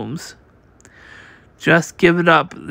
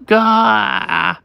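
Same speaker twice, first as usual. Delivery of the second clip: high startled "gah"; pained yelp; 4 semitones higher than usual. Acted. A crew member yelps as the rug bear strikes, pained cry. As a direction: yelp as you get hit.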